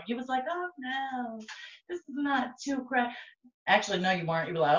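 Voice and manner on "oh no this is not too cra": soft, high imitation of a listener; small, high mock-reassuring voice; mock polite denial. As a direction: quietly mimic someone politely playing it down. Retold listener reaction, then retracted.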